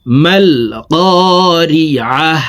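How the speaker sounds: slow, drawn "করিআহ" closing in a breath of h; pausal h ending. Shown as a demonstration. Teacher showing the stop on round ta, "করিআহ" closed with a silent-vowel h. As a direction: end "করিআহ" on a still, audible "হ". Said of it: "হাকে সাকিন করে ফেলবো".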